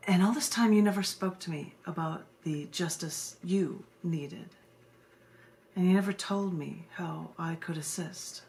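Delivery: slow, low, solemn poetry reading; poem reading. Acted. Poet reading her justice poem to a live audience, solemn and low. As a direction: read it like a grave spoken-word poem.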